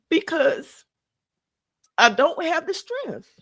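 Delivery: fighting to keep from crying; emotional. Real, not acted. Mourning friend admits she can't call, tearful. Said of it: "I'm getting emotional now".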